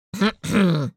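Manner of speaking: deliberate throat clear; acted attention-getting cough. A narrator's reading. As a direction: clear your throat to be noticed. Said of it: "Громко откашлялся кто-то".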